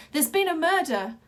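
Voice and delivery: loud, high alarm shout; crying out. A narrator's reading. Wild Boy bursting into the big top, shouting murder loudly. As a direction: raise the alarm at full voice. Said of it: "he cried".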